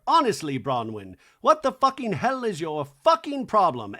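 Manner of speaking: loud, emphatic mock outrage; angry rant. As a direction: read it out as a furious message. Acting out Jared's furious message.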